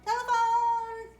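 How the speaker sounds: excited cue word. Shown as a demonstration. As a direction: call out Telephone as a cue. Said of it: "What's the telephone do?".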